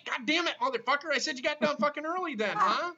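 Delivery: loud, high-pitched cursing rant; exasperated outburst. Real, not acted. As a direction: snap loudly, fed up with the caller. Host fed up with caller's muddle.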